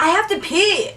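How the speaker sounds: mock high-pitched announcement; drunk friend's announcement. Heather gets up, mock drunk announcement. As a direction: lightly imitate a tipsy friend, high-pitched.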